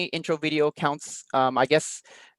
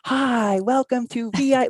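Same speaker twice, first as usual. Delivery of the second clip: high, bright-pitched mock teacher greeting; over-exaggerated teacher intro; 10 semitones higher, 4 dB louder, slower than usual. Mocking a teacher's intro video, over-exaggerated and high. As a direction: overdo the cheery welcome like a cheesy intro video. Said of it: "like, over-exaggerated".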